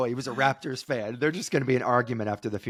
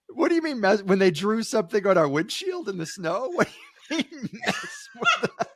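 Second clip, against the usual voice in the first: high-pitched, disbelieving retold challenge; play-acted outrage breaking into laughter; incredulous; 8 semitones higher than usual. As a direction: question him as if he's lost it.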